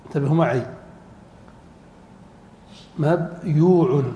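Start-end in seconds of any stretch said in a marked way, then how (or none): 3.0-4.0 "مبيوع" slowly split as mab, yuu-un; syllable by syllable. Shown as a demonstration. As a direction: pause after mab in "مبيوع", then a long yuu. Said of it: "الضمة".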